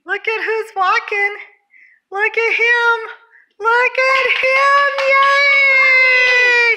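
building to a high, loud cheer; excited cheering. Real, not acted. Cheering toddler's first steps.

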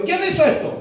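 high, startled acted question; mock alarm, pitched right up; shocked demand. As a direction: play a nurse appalled at a wound. Acted nurse sees the wound.

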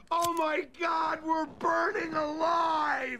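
slow, high mock screaming cry; burning scream. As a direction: wail it like you're on fire. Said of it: "And your family screaming".

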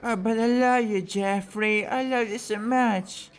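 mock lovesick voice. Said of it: "Why the fuck am I talking like this?".

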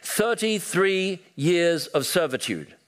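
slow, high-pitched oratory; drawn-out rallying opening line, pitched high; imitated orator. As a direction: declaim it like a speech to a crowd. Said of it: "he starts by saying".

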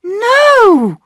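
put-on a girl's sharp protest; cried out. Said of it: "cried Bobby".

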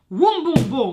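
loud, high booming crash sound; booming thud. A narrator's reading. Narrator booming the crash of a landing dragon. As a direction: boom it out like a heavy crash.

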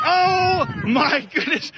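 high, slow, shouted amazement; can't believe your eyes; amazed cry. Real, not acted. Amazed watching him move.

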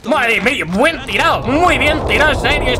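ordinary talk

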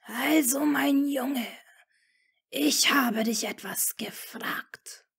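the Dark Lord demanding an answer from Draco; menacing demand. A narrator's reading. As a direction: press the boy with a hissing threat. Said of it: "setzte er schmetternd hinzu".

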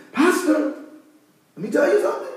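high-pitched imitation of a church mother; Mother Ada. Church mother calling on her pastor during prayer, high-pitched imitation. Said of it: "Mother Ada says".

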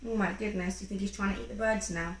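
ordinary delivery

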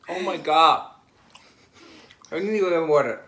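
disgusted, with a mouthful; loud, grossed out while chewing; eating gross jelly beans. Real, not acted. Reacting to a bad bean.

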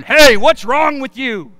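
a stern heavenly yell, mildly loud; half-hearted scolding shout from above; mock yell. Imagined God yelling down at a failing runner, mock scolding. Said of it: "God doesn't yell down".

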